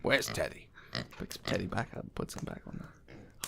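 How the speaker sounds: soft, low stage directions; quiet narration. A narrator's reading. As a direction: murmur the action quietly. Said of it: "GM narrating actions quietly".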